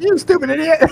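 high, loud retold outburst; mock insult. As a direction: imitate your sudden snapping at a friend. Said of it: "then I was like".

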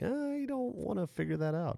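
mock high squeak; high squeaky voice. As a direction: squeak it out in a falsetto.

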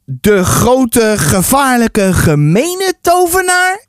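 slow, drawn-out question climbing high; questioning repeat. Acted. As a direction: repeat it slowly, building to a question. Narrator repeats boy's claim, questioning.

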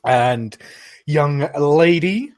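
high, loud welcoming barmaid. Acted. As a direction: welcome them warmly in. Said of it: "She goes".

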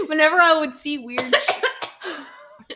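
slow, laughing through the words; fighting back laughter, drawn out. Real, not acted. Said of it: "are you just, like".

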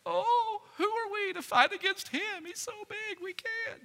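high, whiny scared soldiers; mock whimpering. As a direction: whimper it like a frightened coward. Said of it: "they ran whimpering".

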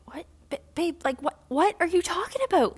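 high-pitched incredulous quote; baffled boyfriend. Retold or imitated. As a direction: imitate a boyfriend completely baffled. Imitating boyfriend's baffled reaction.

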